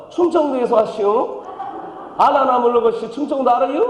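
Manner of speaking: hammed-up Chungcheong country accent; Chungcheong dialect. Pastor playing contestant three in a hammed-up Chungcheong dialect. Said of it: "삼 번".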